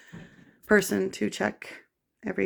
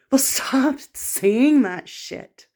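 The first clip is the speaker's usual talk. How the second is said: slow, louder, higher angry snap; angry retort; 5 semitones higher, 5 dB louder, much slower than usual. Real, not acted. [name] fed up with people calling her suicidal, angry retort. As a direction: bite back at them, word by word. Snapping back at accusers.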